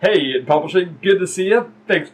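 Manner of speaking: hiccuping while talking; hiccups. Real, not acted. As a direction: hiccups breaking the words. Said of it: "I just caught the hiccups".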